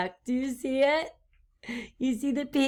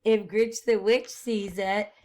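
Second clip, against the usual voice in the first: ordinary delivery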